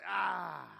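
loud mock scream of protest; imitated scream. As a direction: cry out like a world recoiling. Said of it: "our world is screaming this".

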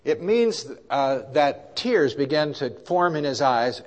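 ordinary talk, nothing marked

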